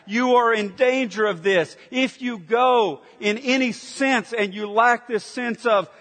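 ordinary talk

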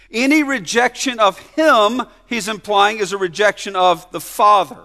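loud, high preaching; full-force preaching. Acted. Pastor pressing the point on the congregation, preaching hard. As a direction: drive it home from the pulpit.

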